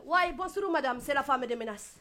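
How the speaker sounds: put-on a light Koulibaly-style accent; West African accent. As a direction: answer the phone as a streetwise African woman. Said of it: "Marie Curie en mode Koulibaly".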